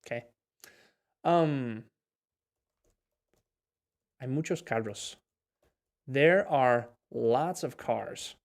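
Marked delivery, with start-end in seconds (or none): 6.1-8.4 slow model line. Shown as a demonstration. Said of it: "We can say either one".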